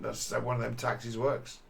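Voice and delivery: ordinary talk, nothing marked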